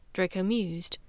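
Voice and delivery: ordinary delivery, nothing marked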